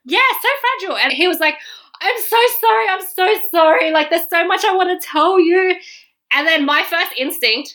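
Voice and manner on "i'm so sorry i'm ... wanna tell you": high-pitched mock sobbing apology; imitated tearful apology. Crying date apologizes to her, imitated high tearful plea. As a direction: plead as if crying, pitched right up. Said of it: "he was crying, and he's like".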